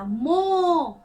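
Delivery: मो loud with a long, held O; teacher's loud, drawn-out मो; O vowel demo. Shown as a demonstration. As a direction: stretch मो out on the O. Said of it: "ओ की तरह".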